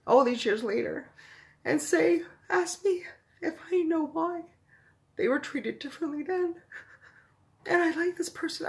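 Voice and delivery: high, tearful voice breaking into sobs; crying. Real, not acted. Host tearfully thanking a viewer who reached out, crying. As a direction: choke the words out through real tears. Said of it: "Sorry, I'm crying".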